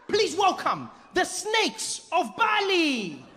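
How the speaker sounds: high-pitched showman announcer; hammed-up ringmaster introduction; Deng Basan. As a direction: present the act grandly, stretching the last words. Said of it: "he looks at us and he's like".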